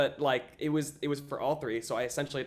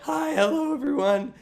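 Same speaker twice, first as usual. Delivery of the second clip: much higher, louder nervous hello; squeaky nervous greeting; 13 semitones higher, 7 dB louder than usual. Retold or imitated. Nervous teen greeting the casting panel, high squeak. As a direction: squeak out a jittery hello to the room. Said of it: "that's how I sounded".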